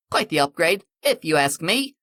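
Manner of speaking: cockney smugness. Acted. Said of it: "some cockney jackass".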